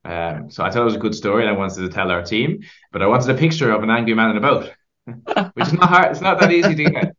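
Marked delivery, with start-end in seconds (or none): 4.9-7.2 laughing. Real, not acted. Laughing about the picture.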